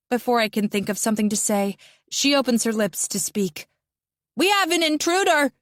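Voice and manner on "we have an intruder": high alarmed shout. A narrator's reading. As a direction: raise the alarm to the whole bus. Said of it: "she shouts".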